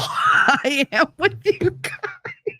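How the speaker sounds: breaking up into laughter mid-sentence; high, slow, words dissolving into laughs; laughing. Real, not acted. Streamer insists she is gentle with followers, laughing.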